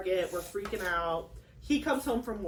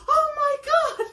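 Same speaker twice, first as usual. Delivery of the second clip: much higher, louder laughing cry; laughing shriek; 6 dB louder than usual. Retold or imitated. Stepdad reacting to the news, laughing high shriek. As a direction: squeal it through laughter. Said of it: "he was like".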